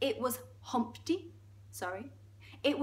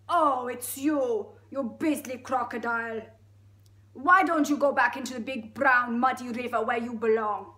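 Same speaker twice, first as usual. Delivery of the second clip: loud, indignant, not-quite-Russian elephant; rough Russian accent; 2 semitones higher, 8 dB louder than usual. Acted. Elephant scolding the beastly crocodile, rough Russian accent. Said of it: "It's kind of Russian".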